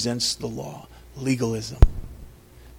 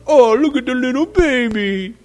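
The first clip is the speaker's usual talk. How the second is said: high sing-song mock of cooing at a baby; 11 semitones higher, 5 dB louder than usual. Older boys mocking Sarah's weaned baby, high sing-song taunt. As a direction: tease the baby like a mean older boy. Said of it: "And they're mocking it".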